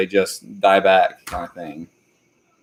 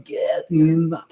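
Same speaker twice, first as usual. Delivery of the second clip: Scottish accent attempt; 7 semitones higher, slower than usual. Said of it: "I can't do a very good Scottish accent".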